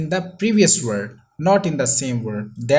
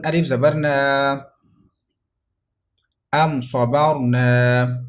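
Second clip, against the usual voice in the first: slow, loud Quran spelling-out, syllable by syllable; slow spelling drill; 4 dB louder, much slower than usual. Shown as a demonstration. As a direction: spell each letter slowly for pupils to repeat. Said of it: "Revise after me".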